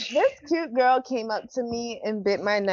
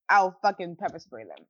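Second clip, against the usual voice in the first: usual speaking voice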